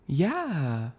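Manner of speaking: high-pitched, drawn-out waitress reply; lightly put-on high voice. A narrator's reading. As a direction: swoop up high on one long word. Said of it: "in a high-pitched tone".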